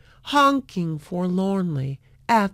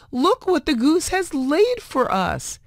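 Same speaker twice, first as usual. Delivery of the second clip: excited; 9 semitones higher than usual. A narrator's reading. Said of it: "she cried".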